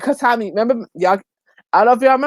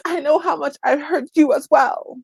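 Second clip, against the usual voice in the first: higher-pitched imitation of a tearful apology; mock tearful apology; 7 semitones higher than usual. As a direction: mimic a crying apologizer, lightly. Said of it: "she's crying and she's in tears".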